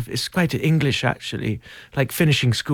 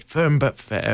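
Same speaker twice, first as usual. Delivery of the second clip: light Scottish lilt as the nanny character; 3 semitones higher, slower than usual. Acted. Answering the interviewer in a light Scottish nanny accent. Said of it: "Are you Scottish in it?".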